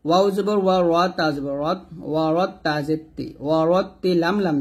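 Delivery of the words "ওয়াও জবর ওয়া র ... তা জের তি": ordinary talk, nothing marked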